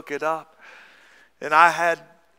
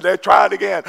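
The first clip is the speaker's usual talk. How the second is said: rising shout; 4 semitones higher, 8 dB louder than usual. Acted. Preacher building to the wolf's blow.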